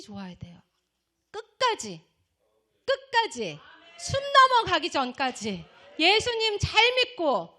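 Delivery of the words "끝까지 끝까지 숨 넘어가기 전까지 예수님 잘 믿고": loud, raised preaching call; emphatic exhortation. Real, not acted. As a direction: hammer each phrase home to the flock. Preacher urges congregation to endure.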